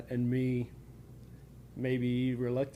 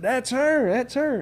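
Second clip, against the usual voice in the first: higher, louder excited call; 10 semitones higher, 7 dB louder than usual. Retold or imitated. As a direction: eagerly point her out. Teacher excited in retold story.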